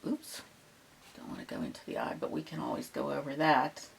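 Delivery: ordinary delivery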